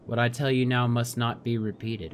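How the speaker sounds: ordinary talk, nothing marked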